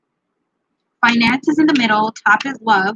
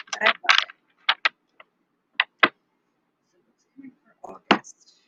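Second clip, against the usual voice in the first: whisper; 2 semitones higher, 4 dB quieter, slower than usual. Real, not acted. Reader whispers aside.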